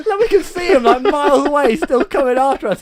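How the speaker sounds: high, loud, breaking into laughter; laughing hysterically. Real, not acted. Teller recalls the minotaur still chasing, laughing hysterically. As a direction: tell it through helpless laughter. Laughing hard at own story.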